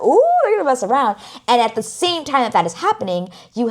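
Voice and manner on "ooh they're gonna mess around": high, loud, quick teasing squeal; gleeful gossip about the couple, fast; teasing ooh. Retold or imitated. Viewer gossiping about the couple kissing, high teasing squeal. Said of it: "I'm, like, thinking".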